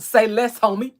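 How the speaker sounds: put-on loud, punchy Compton accent. Streamer demonstrating her Compton accent, tough and loud. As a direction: hit it hard in a Compton accent. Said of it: "that's my Compton accent".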